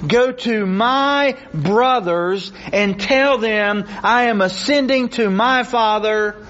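high, insistent pulpit delivery; impassioned preaching. Real, not acted. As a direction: drive each phrase home to the congregation. Preaches on the passage at full force.

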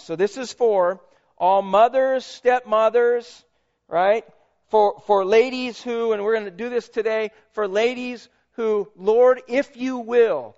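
sermon tribute read with lifted voice. Acted. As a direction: proclaim it to every mother in the room. Mother's Day tribute read from the pulpit.

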